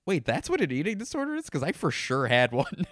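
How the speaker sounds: high, quick disbelief tipping into laughter; laughing realization. Retold or imitated. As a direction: blurt the mock-shocked question, then crack up. Quoting own realization, cracking up.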